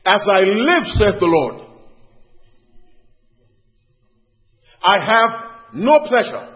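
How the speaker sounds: high, loud, declaimed divine oath; forceful preacher voicing God's oath; solemn oath. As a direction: proclaim it as a sworn vow from God. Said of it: "Making an oath".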